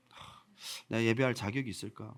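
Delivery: low, soft self-doubt after a sigh; acted guilty feeling. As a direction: murmur it to yourself, unworthy. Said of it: "죄스러운 마음이 드는 거". (죄스러운 듯 낮고 작게 혼잣말)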